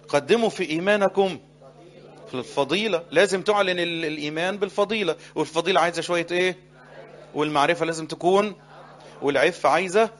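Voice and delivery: preaching at full force; loud recitation. Real, not acted. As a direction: declaim the verse to the crowd. Reciting verse with congregation.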